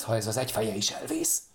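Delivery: ordinary delivery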